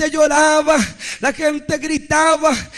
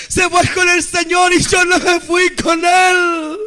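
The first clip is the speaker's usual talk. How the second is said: acted-out cry of someone left behind; preacher's impassioned mock wail; anguished wail; 3 semitones higher than usual. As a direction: draw out the lament, rising in anguish. Acts out man left behind.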